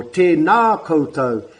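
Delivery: "tēnā koutou" drawn out slowly; slow intonation example. Shown as a demonstration. Teacher showing intonation on a greeting, slow. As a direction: stretch "tēnā koutou" evenly. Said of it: "that's your, um, intonation".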